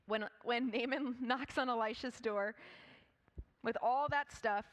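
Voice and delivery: ordinary talk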